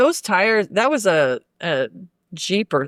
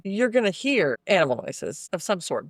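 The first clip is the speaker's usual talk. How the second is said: ordinary talk, nothing marked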